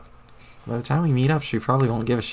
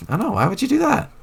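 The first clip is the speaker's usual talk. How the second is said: much higher, dismayed cry; alarmed dismay; 14 semitones higher than usual. Acted. Hearing of a stabbing plan, high-pitched dismay. Hears plan to poke holes in girl.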